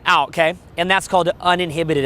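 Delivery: usual speaking voice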